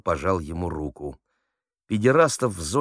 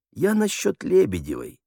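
high-pitched innocent clarification; clarify meekly in a lifted voice; placating, pitched well up; explaining; 11 semitones higher than usual. Acted. Prisoner explains to offended officer.

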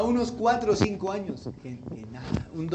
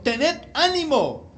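high, slow, projected call; 7 semitones higher, 4 dB louder, much slower than usual. A narrator's reading. Jesus to the frightened disciples on the sea, calling out. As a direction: call out firmly, drawing the words out.

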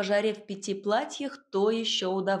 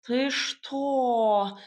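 drawn-out, stunned cat voice; aghast cat; 3 semitones higher, 3 dB louder than usual. A narrator's reading. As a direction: stretch the question in disbelief. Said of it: "вытаращился кот".

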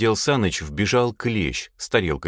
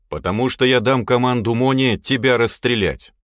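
louder, higher commanding voice; stern threat; 4 semitones higher, 6 dB louder than usual. Acted. Boss threatens to have the lad shot, stern. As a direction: threaten him firmly and loudly.

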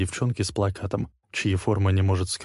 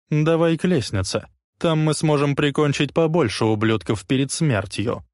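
put-on resolute, raised young man's voice; determined young samurai; 6 semitones higher, 3 dB louder than usual. A narrator's reading. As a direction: say it with grim resolve. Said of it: "Решимости в его голосе".

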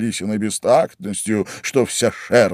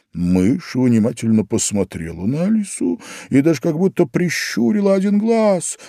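usual speaking voice

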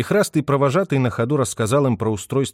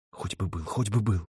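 soft, quiet pleading murmur; anxious whisper; 3 semitones lower, 7 dB quieter than usual. Acted. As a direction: pray under your breath. Lipa's anxious hope. (тревожный шёпот)

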